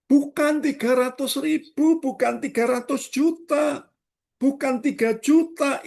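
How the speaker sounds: high, loud preacher's list, each item hit; fiery preaching. Acted. Pastor voices the cheated man's outrage, preaching at full force. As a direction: build the outrage line by line.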